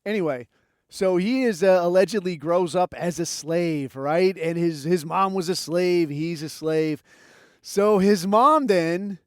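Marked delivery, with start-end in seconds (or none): none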